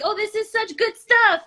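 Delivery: high-pitched, re-enacted enthusiasm; retold delight. Host tells guest her reaction to a live, retold delight.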